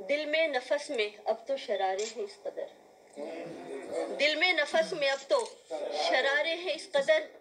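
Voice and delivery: measured mushaira verse, lifting on the repeats; poetry recitation. Acted. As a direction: declaim the couplet to the audience. Recites ghazal couplets.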